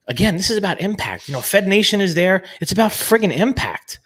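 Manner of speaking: super fired up, thick Jersey accent. Real, not acted. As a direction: drive the point home, all fired up. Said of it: "Rich is super fired up 'cause he lays that Jersey accent on thick".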